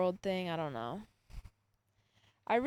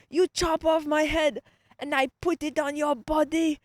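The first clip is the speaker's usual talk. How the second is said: loud, raised imitation African accent; 7 semitones higher, 7 dB louder than usual. Student mimicking professor's odd sibling plan, African accent. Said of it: "I guess he was African or something".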